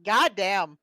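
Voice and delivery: a real burst of frustration; loud goddamn, half laughing; loud exasperated curse. Real, not acted. Stung by the sailor's retort.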